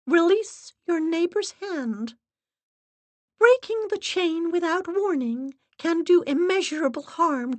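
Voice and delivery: high, loud, measured warning; stern assistant. Acted. Assistant warns the séance guests, high and stern. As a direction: lay down the rules firmly.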